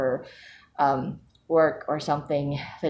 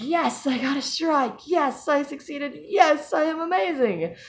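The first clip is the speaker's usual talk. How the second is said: high, drawn-out triumphant yes; playfully imitating her strike cheer; slow proud celebration; 12 semitones higher, slower than usual. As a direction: savour each yes like a victory lap. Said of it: "I just automatically was like".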